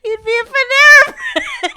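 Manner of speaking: laughing while saying it; cracking up mid-joke. Real, not acted. Repeats joke through laughter.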